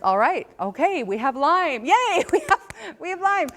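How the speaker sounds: husband celebrating the diagnosis, hammed-up; mock cheer.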